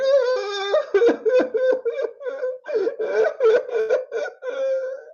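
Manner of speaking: high, wailing fake sob-laugh; imitating the troll crying at his page; mock crying. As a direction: blubber like a crybaby to mock him.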